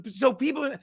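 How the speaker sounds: normal talking voice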